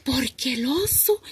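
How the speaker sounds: put-on hushed whisper to the children; suspense whisper. A narrator's reading. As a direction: whisper it, the bear is awake. Suspense after bear wakes.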